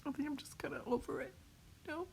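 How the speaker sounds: on the edge of breaking down; real crying. Real, not acted. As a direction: let tears creep in and lose volume. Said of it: "I know I'm crying right now".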